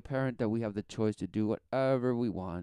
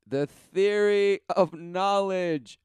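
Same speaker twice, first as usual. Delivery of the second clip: high, slow and mocking; sneering mockery; 8 semitones higher, 7 dB louder, much slower than usual. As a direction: lean into the sneer.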